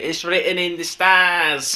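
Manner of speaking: drawn-out commentator line, half-hearted; Gary Neville commentary. Retold or imitated. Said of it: "as Gary Neville would say".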